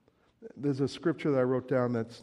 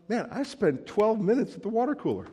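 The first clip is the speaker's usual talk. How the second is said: exasperated realization; 11 semitones higher, 6 dB louder than usual. Pretend. Preacher voicing a time-waster.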